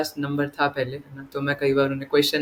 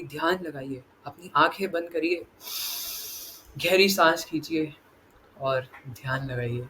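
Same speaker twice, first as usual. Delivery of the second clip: imitating a calm meditation instructor; mock meditation guide; 6 dB quieter than usual. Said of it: "कई लोग बोलते हैं". (ध्यान कराने वाले की धीमी नकल)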